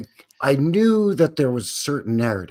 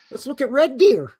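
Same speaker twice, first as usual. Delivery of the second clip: high, loud Canadian hoser voice with a big eh bud; hammed-up Canadian hoser; 13 semitones higher, 5 dB louder than usual. Teasing co-host about Canadian places, hammed-up Canadian hoser voice. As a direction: play the over-the-top Canadian buddy.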